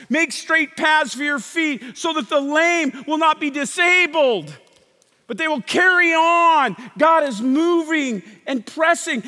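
loud, high, stretched-out exhortation; forceful preaching. Real, not acted.

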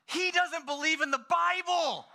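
high, drawn-out tattling; high-pitched, outraged child voice; appalled kid. Retold or imitated. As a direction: imitate a scandalized eight-year-old telling on a friend. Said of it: "you gotta chill".

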